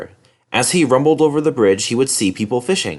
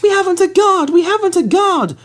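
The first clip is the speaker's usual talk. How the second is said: very high urgent coach voice; anxious coach; 4 dB louder than usual. Acted. Annie tries to warn Thomas, very high and urgent. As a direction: call out in alarm.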